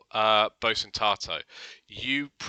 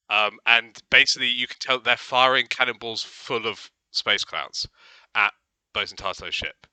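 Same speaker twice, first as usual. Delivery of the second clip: ordinary talk, nothing marked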